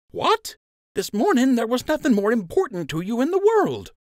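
high, incredulous outburst; can't believe it; shocked friend. Acted. Lund shocked Joe refuses.

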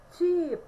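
Cheap with a long stretched ee, said loud and clear; long ee vowel. Shown as a demonstration. Teacher showing students the vowel, long stretched ee. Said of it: "tem o som de I prolongado".

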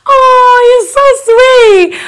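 high, loud, slowed gush; gushing back at a loving fan; touched squeal. Real, not acted. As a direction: coo it out, moved. Touched by fan's message.

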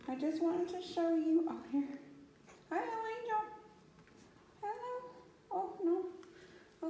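sing-song high greeting to a kitten. Real, not acted. Owner greeting the kitten Angel in high baby talk.

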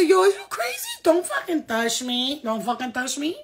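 high, loud outraged yell at the unseen spirit; retold yelling. Yelling at the spirit that moved her arm, high and loud. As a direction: shout it like someone just grabbed you in bed. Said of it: "I said".

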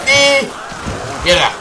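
slow, stretched yelled curse; drawn-out curse shout. Real, not acted.

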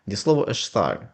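normal talking voice

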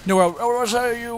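words tumbling out slurred in the rush. Real, not acted. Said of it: "slurred my words right there".

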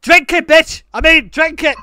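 loud, high shouted command; mock bossy order. Prankster ordering stranger to drink, mock-bossy shout. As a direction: bark it as a joking order.

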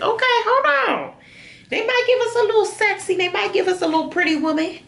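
high, fast, playful squeaky voice; excited squeal. Real, not acted. Vlogger to viewers about sexy tights, high playful squeal. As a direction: squeal it out, teasing and delighted. Teasing herself trying on tights.

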